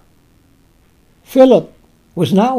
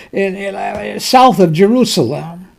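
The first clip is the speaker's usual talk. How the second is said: ordinary delivery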